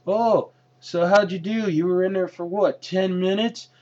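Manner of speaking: imitating a cocky wrestler teasing. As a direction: needle him with a smug high voice. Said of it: "he gets a little bit cocky".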